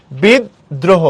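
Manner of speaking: "বিদ্রোহ" split as bid-droho; দ double. Shown as a demonstration. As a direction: double the d in "বিদ্রোহ", pausing between. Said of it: "তাহলে double হয়েছে". ("বিদ্রোহ"-তে দ দ্বিত্ব করে)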